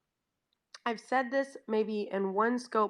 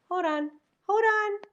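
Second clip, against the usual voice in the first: rising hold on; 12 semitones higher than usual. Real, not acted. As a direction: call out to the dog, climbing in pitch. Settling the drugged dog.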